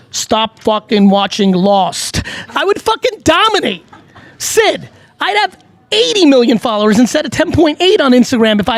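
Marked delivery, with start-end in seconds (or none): 0.1-2.2 slow, mock-stern Russian accent; Ivan Drago Russian accent. Host imagining his advice in character, slow Russian accent. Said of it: "Ivan Drago-like accent". 2.5-7.3 high-pitched, emphatic enthusiasm; excited boast. Real, not acted. Host fantasising about having an accent, excited and high.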